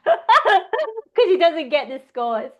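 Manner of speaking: slow, laughing through the words. Real, not acted. Admitting to her co-host she fakes an English accent, laughing.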